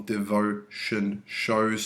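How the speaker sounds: slow "Devotion" broken into de-vo-tion, then "shows"; syllable by syllable. Shown as a demonstration. Teacher showing a three-syllable word, "Devotion" slowly broken up. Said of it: "we've got three syllable here".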